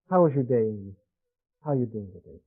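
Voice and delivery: soft, low gentle father voice; tender bedtime question. Retold or imitated. Father tucking daughter in, soft and tender. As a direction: ask her softly as you stroke her hair.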